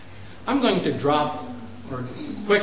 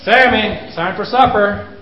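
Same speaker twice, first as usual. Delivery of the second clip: put-on raised call to the child; calling to dinner; 4 semitones higher, 7 dB louder than usual. Shown as a demonstration. Parent calling child to supper, raised voice. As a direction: call Sammy in for supper, a bit loud. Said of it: "until your voice is way up here".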